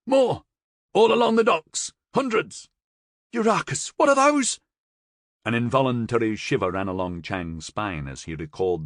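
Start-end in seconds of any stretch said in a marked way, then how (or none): 0.0-4.6 very high, loud panicked screams; terrified shouting. A narrator's reading. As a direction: scream in horror at the monsters. Soldier screams over the speaker.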